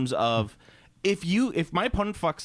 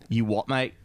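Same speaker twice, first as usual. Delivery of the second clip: put-on British accent; 5 semitones lower than usual. Answering a lore critique as a Brit, British accent. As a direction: lower mock-British challenge, amused. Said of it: "it would be like".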